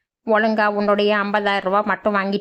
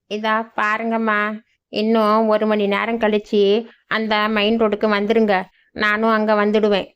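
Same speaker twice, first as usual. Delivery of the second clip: normal talking voice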